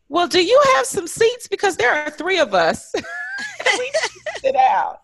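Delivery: high, loud demand breaking into a laugh; hammed-up exasperation, laughing through it; exasperated laughing plea. Retold pointed demand for seats.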